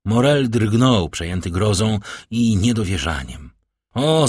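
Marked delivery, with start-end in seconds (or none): 3.9-4.3 higher, sudden "oh"; raised narrated exclamation; exclaimed cry. A narrator's reading. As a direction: cry out in protest. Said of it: "zawołał Villefort".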